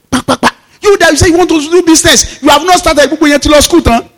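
a hint of a nagging sister, pitched high; scolding sister. Sister at the door nagging him about business, high-pitched.

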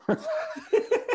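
sudden high-pitched laugh; high burst of laughter. Real, not acted. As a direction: break into a squealing laugh. Laughing at the wedding date story.

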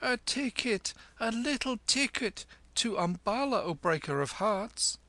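high, playful coaxing; mock-flirting high voice; laughing tease. A narrator's reading. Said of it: "she laughed".

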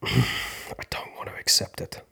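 sighing whisper, barely voiced; whispered refusal. Real, not acted. Refusing to accept the loss, sighing whisper. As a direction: whisper it after a sigh. Resisting acceptance, first take.

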